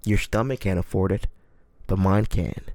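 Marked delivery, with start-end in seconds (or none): none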